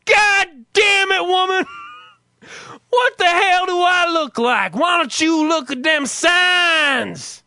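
high, loud, drawn-out Southern holler; put-on Southern accent. Shown as a demonstration. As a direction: bellow at the wife as a hammed-up Southern husband. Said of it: "I pretend I'm from the South".

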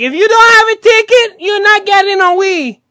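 high, loud crowd-control holler; shouted warning. Retold or imitated. Store staff warning people without tickets, shouted.

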